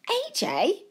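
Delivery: mild high-pitched shout. A narrator's reading. Joe spotting his friend in the crowd, mildly shouting, high. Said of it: "Joe shouted".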